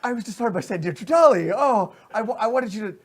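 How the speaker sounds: high, loud breathless fanboy; starstruck thrill. Acted. Goblin guard disarmed by his idol, thrilled.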